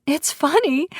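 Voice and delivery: high, near-hysterical voice; high and giddy, on the edge of breaking; hysterical laughter. A narrator's reading. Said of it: "I laugh harder to the point it's hysterical".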